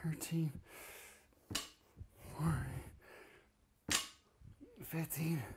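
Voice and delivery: gasping out the rep count; out of breath. Real, not acted. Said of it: "run out of breath".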